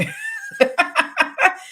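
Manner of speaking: real laugh jumping high; laughing at her own serial killer remark, high burst of laughter. Real, not acted. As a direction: crack up at your own joke.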